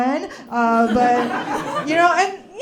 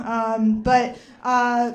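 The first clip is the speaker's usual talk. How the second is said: ordinary talk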